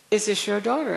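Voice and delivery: normal talking voice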